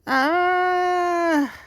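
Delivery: sudden high shrieking scream. Acted. A child's scream that opens the scary story. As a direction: let out a loud terrified shriek.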